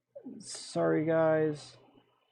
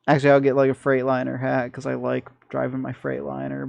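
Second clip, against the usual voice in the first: ordinary talk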